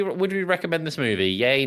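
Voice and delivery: ordinary talk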